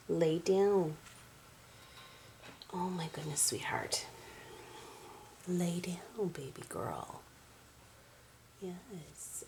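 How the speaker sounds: soft whisper telling the cat to lay down; whispered coaxing. Real, not acted. Owner whispering to her cat to lay down. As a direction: hush her down, barely voiced.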